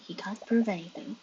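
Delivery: normal talking voice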